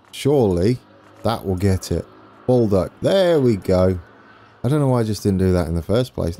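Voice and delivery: ordinary talk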